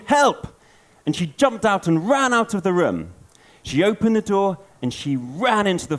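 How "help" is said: high, louder cry for help; mock scream. Goldilocks sees the bears and screams for help. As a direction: shout it like a scared little girl. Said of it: "She screamed".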